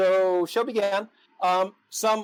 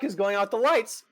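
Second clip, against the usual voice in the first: louder, exasperated demand; angry outburst; 3 semitones higher, 6 dB louder, faster than usual. Retold or imitated. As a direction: snap at the crew in frustration. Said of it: "I'm glaring at people like".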